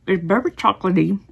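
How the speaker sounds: chewing while talking; mouth full. Real, not acted. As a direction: talk through a sticky mouthful. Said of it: "There's a lot of chewing involved".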